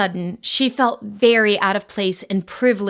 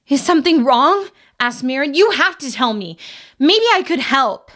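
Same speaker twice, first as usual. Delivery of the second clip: anxious pleading; 6 semitones higher, 4 dB louder than usual. Acted. Marin alarmed, pleading.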